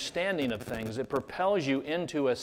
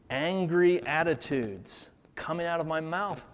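ordinary delivery

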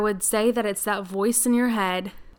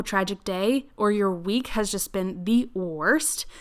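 ordinary talk

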